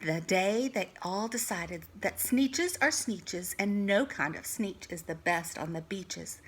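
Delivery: plain reading voice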